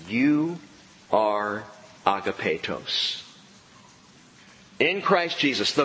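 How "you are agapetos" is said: tender emphasis. Real, not acted. Pastor stressing the key word.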